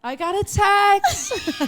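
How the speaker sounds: high, drawn-out dainty squeal; light and cute. Pretend. Co-host retrying the text announcement, light cute squeal. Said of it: "trying to be really light and cute".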